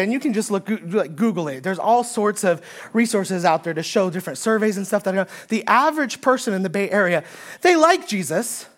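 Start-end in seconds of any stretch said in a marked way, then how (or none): none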